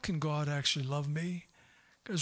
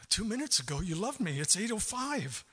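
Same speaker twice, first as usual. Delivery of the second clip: ordinary talk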